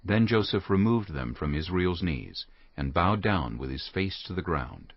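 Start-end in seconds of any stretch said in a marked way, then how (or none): none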